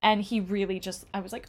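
ordinary talk

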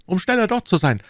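high, loud commands; urgent shouted orders. A narrator's reading. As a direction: rattle off orders in great agitation. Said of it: "rief D'Artagnan".

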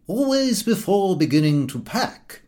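high-pitched uncle dictating a packing rule; Uncle Podger. Acted. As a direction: play the methodical uncle laying down the rules. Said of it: "my uncle would say".

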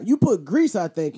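ordinary talk

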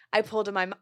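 normal talking voice

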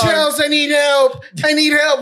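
hammed-up cry for help; panicked yell. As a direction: yell for help like a cook in over his head. Friend mimicked panicking in kitchen.

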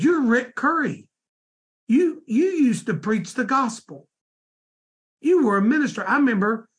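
light imitation of a street minister who is sure it's him; excited recognition. Street minister recognizes fallen preacher.

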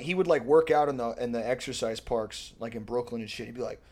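ordinary delivery

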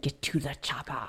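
a hint of Schwarzenegger accent; lightly voiced action hero; Arnold impression. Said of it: "They should have said".

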